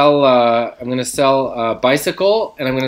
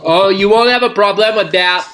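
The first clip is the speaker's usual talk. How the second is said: higher, put-on Quebec French accent; East Coast French accent; 6 semitones higher, 3 dB louder than usual. Seller promising no problems in a put-on French accent. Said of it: "East Coast French style?".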